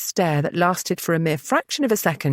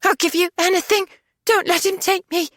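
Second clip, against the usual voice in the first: much higher, panicked begging; desperate pleading; 12 semitones higher than usual. A narrator's reading. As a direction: plead in terror, voice shooting up. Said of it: "she gasped".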